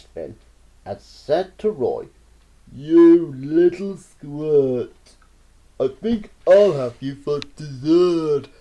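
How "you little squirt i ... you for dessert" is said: slow, loud, gloating threat; menacing anteater. Acted. Anteater corners Roy in the shed, menacing. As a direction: savor the threat.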